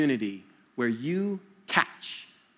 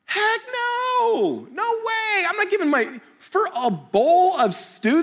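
high, outraged disbelief; a mock outraged no way; incredulous refusal; 3 dB louder, slower than usual. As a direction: throw the offer back, high and amazed. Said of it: "What would be a reasonable response".

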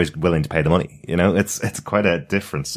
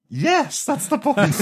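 high mocking yes; slower than usual.

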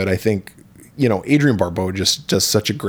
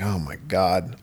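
defeated; 3 semitones lower, 8 dB quieter, slower than usual. Retold or imitated.

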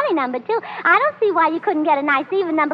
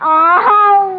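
dismayed cry; 5 semitones higher, 8 dB louder than usual. Acted. Willie's teasing remark about her.

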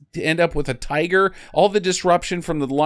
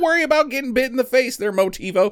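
high, fast carefree reassurance; mock breezy trainer; 8 semitones higher, faster than usual. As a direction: brush off the bite in a high voice. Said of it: "Noah Marshall's like".

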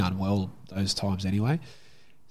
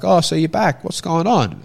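mock doctor voice; 8 semitones higher, 7 dB louder than usual. Imitating student doctor.

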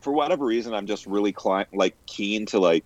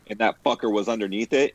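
ordinary talk, nothing marked

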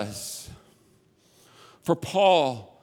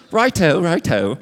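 "Righto, righto", the first one louder, in a playful mock British accent; 5 dB louder than usual. Pastor riffing on his British slip to the congregation, playful mock British accent. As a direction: toss off a jokey British "righto" twice. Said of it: "I'm not British".

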